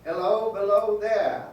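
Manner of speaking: loud slow hail, falling at the end; calling out. Retold or imitated. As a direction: call the exact words back at him, loud. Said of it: "you said those very words".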